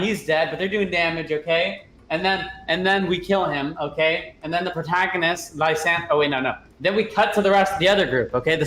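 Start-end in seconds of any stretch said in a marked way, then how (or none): none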